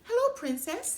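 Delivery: high, chirpy frog voice; frog greeting. Acted. Frog surfacing to greet the princess, high and chirpy. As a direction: pop up cheerfully as the frog. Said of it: "he said".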